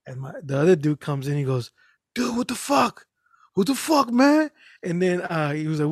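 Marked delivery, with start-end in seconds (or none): 2.1-4.5 higher, rising alarmed cry; alarmed outburst. Retold or imitated. Friend sees the dad's sandwich eaten, alarmed and rising. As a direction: blurt it out in shock.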